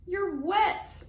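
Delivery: a hammed-up scolding mother; mama howling. Mother at the door howls at muddy kids, loud. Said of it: "Mama howls".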